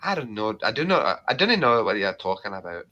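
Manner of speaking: modest denial in a put-on Scottish accent. Streamer brushing off a compliment, Scottish accent. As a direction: deny it in broad Scottish. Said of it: "You're so good at accents".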